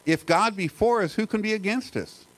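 a preacher lightly voicing Paul in awe; Paul voiced as speechless. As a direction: let Paul sound overwhelmed with nothing left to add. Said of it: "it's like he's speechless".

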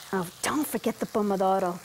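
ordinary talk

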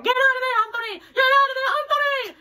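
very high, loud panicked yell; cousin yelling. Retold or imitated. Cousin shouts to Anthony from the background, very high and loud. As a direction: scream it from the porch to pull him out. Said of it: "my cousin in the background".

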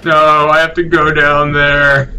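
slow, drawn-out moaning complaint; mock moaning. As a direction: imitate a long reluctant moan. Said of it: "I was like, mo-, moaning".

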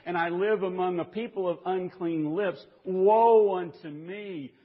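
a broken, rising cry of woe. Retold or imitated. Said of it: "we see Isaiah cry out and say".

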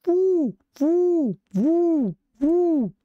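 high-pitched repeated vu hoots; imitated creature hoot. Narrator imitates the forest creatures. (verso acuto imitato, ripetuto)

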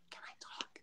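tiny unvoiced whisper of a cowed kid; timid whisper. An imagined scared kid asking the class, timid whisper. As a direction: ask permission in a frightened whisper.

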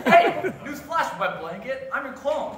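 loud teasing. Acted. Clone mocking partner.

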